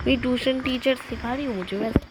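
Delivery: ordinary talk, nothing marked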